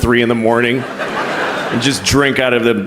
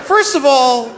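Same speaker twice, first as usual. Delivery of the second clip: much higher, slow indignant rant; outraged; 10 semitones higher, slower than usual. Retold or imitated. Telling off the president on the phone, shrill mock outrage. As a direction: scold the president like you're appalled. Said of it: "And I was like".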